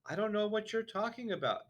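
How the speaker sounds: baffled schoolboy; puzzled boy. A narrator's reading. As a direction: sound lost and confused. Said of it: "even more puzzled than ever".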